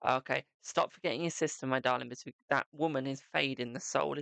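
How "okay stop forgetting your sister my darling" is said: ordinary talk, nothing marked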